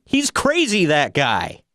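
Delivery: high, exaggerated New York accent shout; thick New York accent. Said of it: "In the most thick New York accent you've ever heard".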